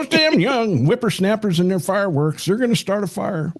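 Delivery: put-on high, cranky old-timer grumble; grumpy old man scolding the kids. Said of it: "So that's my old man voice".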